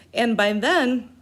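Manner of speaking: ordinary talk, nothing marked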